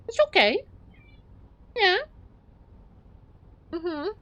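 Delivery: high soothing coo; comforting pet talk. Real, not acted. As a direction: reassure the cat in a high coo. Comforts her cat.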